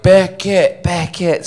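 crowd calling out. Retold or imitated. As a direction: call him out like a crowd summoning him. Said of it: "and they're like".

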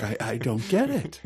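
usual speaking voice

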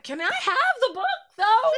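high, pleading ask; high wheedling request. Retold or imitated. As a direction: beg sweetly in a high voice. Retold asking for a book.

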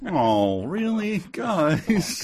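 leaps way up on "really, guys?"; feigned shock at family with knives; mock surprise. Host imagines family drawing knives at Thanksgiving, mock surprise. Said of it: "I'm like".

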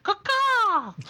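high shrill bird caw; shrill screeching bird woman. Acted. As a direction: screech it like a crow. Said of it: "Cade seems confused".

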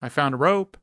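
optimistic. A narrator's reading. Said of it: "Oscar says optimistically".

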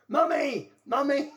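high, loud mock cry for mother; imitated dream cries. Patient crying out in his dreams at night, mock wail. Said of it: "would have dreams and he'd be going".

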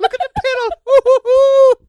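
giggling, squeaky little leprechaun. Retold or imitated. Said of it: "You sound like a little leprechaun".

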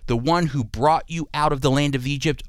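usual speaking voice

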